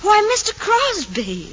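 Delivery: high, slow, coy greeting; 1890s young lady. Acted. Girl greeting a young man at her door, coy surprise. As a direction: greet the caller with drawn-out demure surprise. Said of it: "in the late eighteen nineties".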